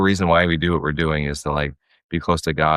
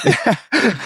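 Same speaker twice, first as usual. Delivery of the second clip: laugh. Real, not acted. Laughs along with wife.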